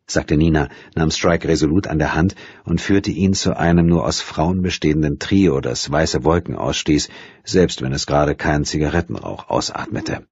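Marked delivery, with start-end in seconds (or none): none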